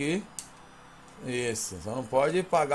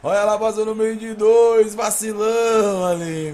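excited commentary; 8 semitones higher, 11 dB louder, slower than usual. Real, not acted. Goal replay, excited narration.

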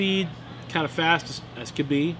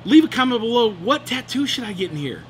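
upbeat call to viewers; 8 semitones higher, 3 dB louder than usual. Real, not acted.

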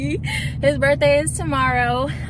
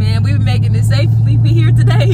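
loud; 11 dB louder than usual. Real, not acted. Defending her driving to him.

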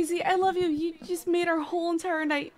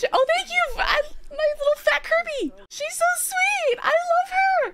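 high gushing squeal; 12 semitones higher than usual. Real, not acted. Streamer gushes over her little Kirby. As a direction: coo over the plush, way up high.

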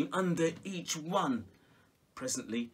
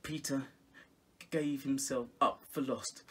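ordinary delivery

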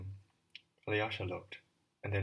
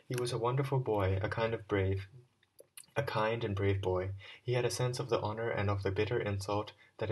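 put-on louder, earnest address to the boys; formal speech; 5 dB louder than usual. Alyosha addresses the schoolboys by the stone, earnest speech. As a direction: speak to them as a solemn farewell.

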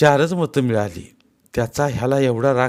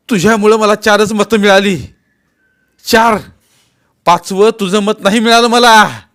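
much louder, higher accusation; angry shout; 11 semitones higher, 10 dB louder than usual. Acted. Patil shouting at his mother over her vote, angry. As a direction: blame her for the lost vote. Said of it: "तो चिडला".

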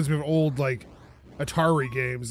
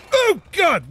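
startled scream; 13 semitones higher, 7 dB louder than usual. Real, not acted. Jump scare in horror game.

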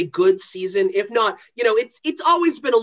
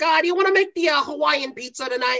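put-on high, sing-song Fargo Minnesota accent; a cheerful Upper Midwest Minnesota lilt; 7 semitones higher than usual. Wife asking about pizza in a Fargo Minnesota accent. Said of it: "we're doing the accent".

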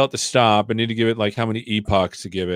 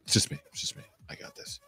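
whisper; 14 dB quieter, faster than usual. Pretend. Joking the computer is not overheating.

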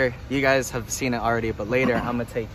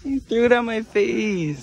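high, slow laughing complaint; real laughing protest; 9 semitones higher, slower than usual. Real, not acted. Friend repeating the complaint after getting hit, laughing protest. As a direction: draw it out through laughter.

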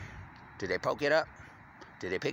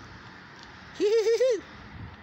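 sudden shrill squeal of a laugh; high laugh burst; 4 dB louder than usual. Real, not acted. Man bursts into a high laugh at his own joke.

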